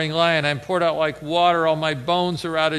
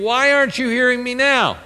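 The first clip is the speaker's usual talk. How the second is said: pleading; 9 semitones higher, 5 dB louder than usual. Retold or imitated. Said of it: "he's saying".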